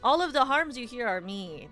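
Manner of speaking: normal talking voice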